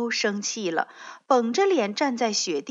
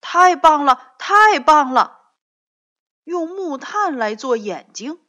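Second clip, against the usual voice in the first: high, very loud joyful cry; thrilled by the snowman plan; excited shout; delighted; 6 semitones higher, 12 dB louder than usual. A narrator's reading.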